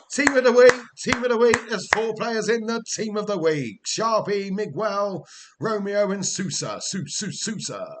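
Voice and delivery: loud, high roll call; excited announcement. Pretend. Streamer announcing his players in team of the week, excited and loud. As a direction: belt out the honours list.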